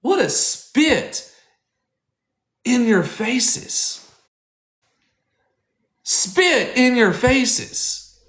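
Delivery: slow, high, each word spat out; slow outraged emphasis. Real, not acted. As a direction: drag it out in disgust. Outraged rant.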